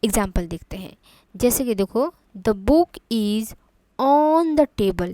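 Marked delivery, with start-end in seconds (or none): 2.4-5.1 slow example read. Shown as a demonstration. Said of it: "teacher reads example slowly".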